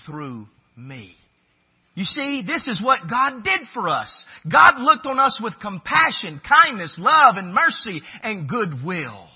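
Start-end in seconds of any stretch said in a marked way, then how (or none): 1.9-9.3 loud, high, driving sermon voice; forceful preaching. Acted.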